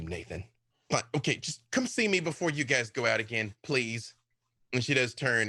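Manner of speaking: ordinary delivery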